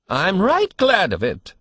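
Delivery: put-on old man's whine. A narrator's reading. Old man in the crib whining to the nurse. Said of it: "whined the old man".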